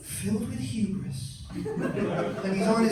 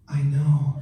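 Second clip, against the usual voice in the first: low-pitched, louder deadpan reply; low voice of a matter-of-fact mother; unimpressed mom; 8 semitones lower, 6 dB louder than usual. Acted. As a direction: answer as the mom who knew all along. Mom's deadpan answer acted.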